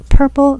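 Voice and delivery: purple repeated high and loud; emphatic repeat. Real, not acted. Telling her birth story, she hammers purple. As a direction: punch the second purple hard.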